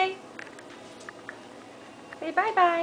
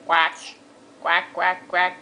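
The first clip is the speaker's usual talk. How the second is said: lower, voiced duck quacks; 8 semitones lower, slower than usual. Shown as a demonstration. Mom answering her own duck question, voiced quacks. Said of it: "What sound does the duck make?".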